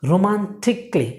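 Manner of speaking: রোমান্টিকলি as a careful ro-man-tik-lee; model pronunciation. Shown as a demonstration. Teacher leading learners to repeat, রোমান্টিকলি ending "tik-lee". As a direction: say রোমান্টিকলি with no "kaa". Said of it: "just আমার সাথে বলুন please".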